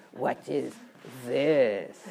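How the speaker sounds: "this" low and leaned on; emphasis placed on "this"; stress on this. Shown as a demonstration. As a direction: land the weight on "this". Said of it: "Or should I say".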